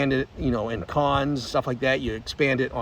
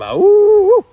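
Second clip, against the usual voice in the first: wolf howl; 11 dB louder, slower than usual. Acted. Signature wolf howl sign-off.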